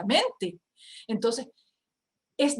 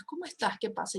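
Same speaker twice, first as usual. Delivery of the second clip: gentle concern; 8 dB quieter than usual. Shown as a demonstration. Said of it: "te acercas y le preguntas".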